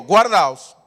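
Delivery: loud repeated warning; loud call and response. Shown as a demonstration. Preacher leading the congregation to repeat, loud. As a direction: lead the congregation in a loud chant. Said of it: "repita conmigo".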